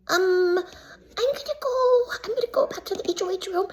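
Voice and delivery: very high, tiny mock-timid voice; awkward escape. Contestant excusing themselves from a screaming match, timid squeak. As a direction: squeak it while edging away from trouble.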